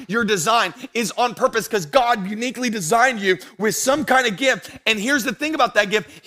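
high, loud, driving pulpit voice; full-force preaching. Real, not acted. Preacher telling church God uses their gifts, full-force preaching. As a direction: lift it and drive every phrase out to the room.

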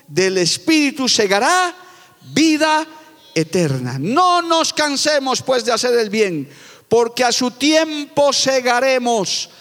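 solemn scripture reading. Acted. Preacher reading Galatians to the congregation, slow and solemn. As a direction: read the verse to the congregation like holy law.

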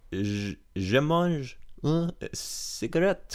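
drawn-out put-on French accent; foreign accent in French. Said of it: "I'm trying to speak French".

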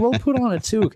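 high, teasing retort voice; mock retort. Friends telling him to put on a toque, teasing high.

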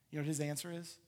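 usual speaking voice

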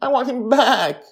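high, tearful plea; a boy still crying for his father. A narrator's reading. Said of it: "Charlie cries".

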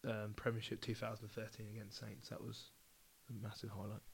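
talking into his hand; muffled, hand over mouth. Real, not acted. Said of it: "not talk into the microphone".

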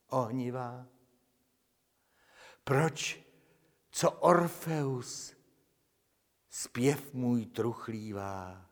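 slow, solemn lyric recitation; melancholy poetry reading; poem recital. Acted. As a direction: declaim the verses with mournful pathos.

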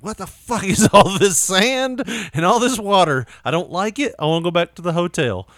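a touch of a spooked little dog; whiny dog. Retold or imitated. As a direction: whine like a dog who hates sand. Dog scared of beach.

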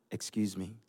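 low, quiet "excuse me"; understated warning, dropped low and soft; stern retold interjection. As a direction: confront queue jumpers under your breath. Retold confronting of queue jumpers.

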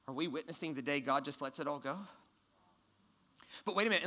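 ordinary talk

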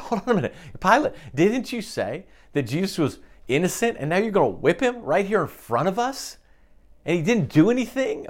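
high, pleading bystander calling out to Pilate; outraged onlooker. Pretend. Bystander in the crowd challenging Pilate: high, indignant protest. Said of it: "watching this and going".

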